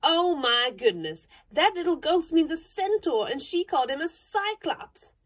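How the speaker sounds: amused exclamation. A narrator's reading. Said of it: "exclaimed Jo, with a burst of laughter".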